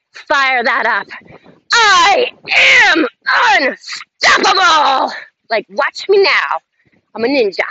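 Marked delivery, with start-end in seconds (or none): 1.7-5.1 much higher and louder, slow belted declaration; shout it from the belly. Shown as a demonstration. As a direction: fire it up from your gut. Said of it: "Say it loud, mean it. Get into that belly".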